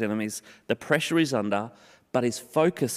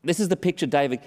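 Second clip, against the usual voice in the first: usual speaking voice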